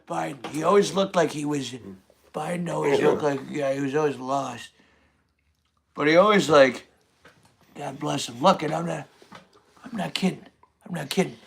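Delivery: soft, lightly voiced old man; a hint of Biden mumbling; Joe Biden impression. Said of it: "Do the Sleepy Joe impression".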